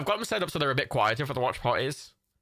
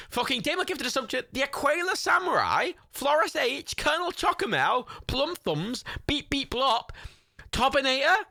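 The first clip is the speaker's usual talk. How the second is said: excited; 11 semitones higher than usual. Real, not acted. Excitedly reading gift sub names.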